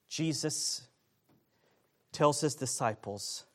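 normal talking voice

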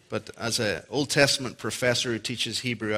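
normal talking voice

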